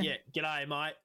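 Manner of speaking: hammed-up ocker Australian; over-the-top Australian TV voice; exaggerated Australian.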